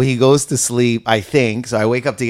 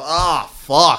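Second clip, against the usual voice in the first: retold dismay; 4 semitones higher than usual. Realizing his mistake, retold.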